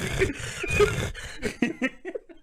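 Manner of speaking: laugh. Real, not acted.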